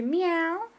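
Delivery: high rising meow; a bright meow for the toddler; cat meow. Shown as a demonstration.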